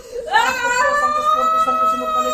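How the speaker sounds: high, loud chanted Latin formulas with shouted Po; exorcism incantation. Real, not acted. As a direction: cast the spirit out at full force.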